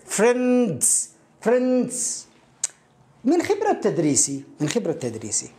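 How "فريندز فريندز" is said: فريندز said slowly with the final s blended on; demonstrated pronunciation. Shown as a demonstration. Teacher sounding out the English word for Arabic learners, careful demonstration. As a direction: draw out فريندز as one syllable, clearly. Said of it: "فأنت ستنطقها".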